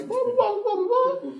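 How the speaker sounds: high-pitched imitation of a rant; hammed-up squeaky blahs; mock ranting. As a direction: babble it high, mocking someone's complaints. Mimics tenant's angry rant.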